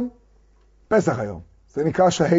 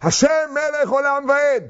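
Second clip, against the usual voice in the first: high, slow synagogue recitation; mock-devout, raised-pitch praying; imitated prayer; 8 semitones higher than usual. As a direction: mimic a congregation praying aloud. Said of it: "שמעתי אותם כל יום בתפילה".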